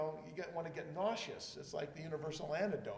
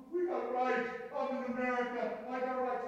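mock indignant citizen; 8 semitones higher, 4 dB louder than usual. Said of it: "You know".

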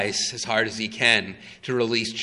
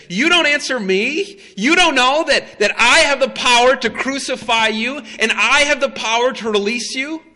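much higher and louder, outraged demand; retold ruler indignant at silence; indignant Pilate; 11 semitones higher, 6 dB louder than usual. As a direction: bristle with offended authority. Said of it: "Pilate is indignant".

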